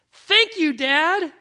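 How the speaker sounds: high, loud, gleeful shout; excited child calling out. Retold or imitated. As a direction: shout thanks as a freed little boy. Freed boy calls out to father.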